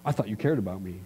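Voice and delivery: soft, low mock-hurt wife; understated wounded complaint; hurt sulk. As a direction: sulk quietly, arms crossed. Sulking wife with arms crossed.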